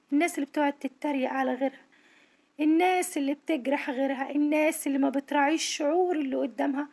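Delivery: normal talking voice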